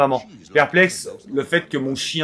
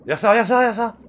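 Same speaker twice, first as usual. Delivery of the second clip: excited; 9 semitones higher, 4 dB louder, faster than usual. Real, not acted. Excited at the game.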